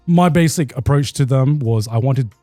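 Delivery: ordinary delivery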